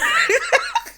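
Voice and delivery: real high cackle; high squealing laugh. Real, not acted. Laughing at own bit.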